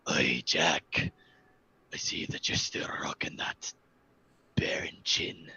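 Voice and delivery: very low, whispery character voice, teasing; mocking. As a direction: tease an old acquaintance in a low, breath-heavy voice. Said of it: "definitely in a mocking manner".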